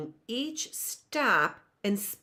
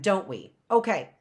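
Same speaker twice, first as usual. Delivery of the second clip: ordinary delivery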